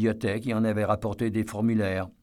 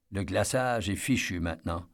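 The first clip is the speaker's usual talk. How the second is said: ordinary delivery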